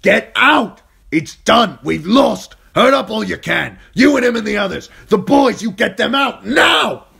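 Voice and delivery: high, full-throated urgent shouting; screaming orders. A narrator's reading. Yoren ordering the children to flee a lost fight, screamed. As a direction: scream desperate commands over a battle. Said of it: "screaming in her face".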